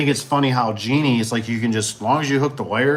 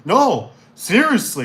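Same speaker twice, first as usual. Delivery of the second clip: mock insistence; 9 semitones higher, 4 dB louder, slower than usual. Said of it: "You're like".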